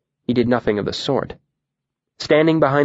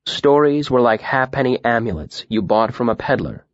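plain reading voice

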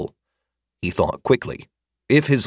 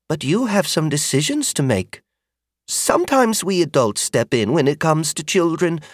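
higher, earnest motherly voice; kindly older woman; 7 semitones higher than usual. Acted. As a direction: reason with a child kindly. Magda explains decisions.

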